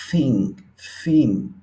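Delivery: tongue between teeth for thin; th sound. Shown as a demonstration. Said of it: "va a ser como una T H".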